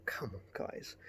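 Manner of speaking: a fed-up whisper to the viewers; exasperated whisper. Real, not acted. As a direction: breathe out Come on, guys in a soft whisper.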